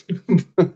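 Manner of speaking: brief self-amused laugh; short laugh. Real, not acted. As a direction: laugh at yourself. Laughs at own paradox.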